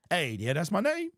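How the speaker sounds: ordinary delivery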